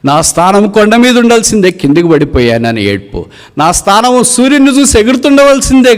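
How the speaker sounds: impassioned, very high, loud outcry; eagle's lament. Acted. Fallen eagle laments its place on the mountain, impassioned outcry. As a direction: cry out the lost place at full height. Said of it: "నేనే నా ఏడుపు".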